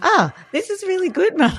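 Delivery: high, childlike mock voice; son's delight. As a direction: mimic her young son saying he loves it. Mum voices her son's delight.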